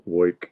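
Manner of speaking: "woik" with an oy vowel for work; New York "woik". Shown as a demonstration. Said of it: "a fun way to pronounce".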